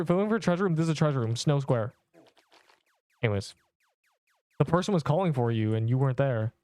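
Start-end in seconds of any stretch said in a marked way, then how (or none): none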